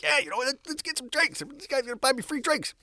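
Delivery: high-pitched giddy self-imitation; all excited. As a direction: gush it high, eager for the freebie. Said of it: "I was all excited".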